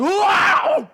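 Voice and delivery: high, loud startled wow; startled shout. Retold or imitated. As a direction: jolt awake and yell it. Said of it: "you're like".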